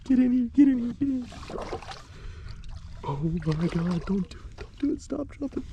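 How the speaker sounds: squeaky high voice begging the fish; high-pitched pleading to a fish; high coaxing plea. Real, not acted.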